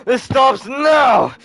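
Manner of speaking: slow, loud, high-pitched command; acted battle cry; heroic shout. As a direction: draw it out as a forceful order. Hero acted, ordering the fight stopped.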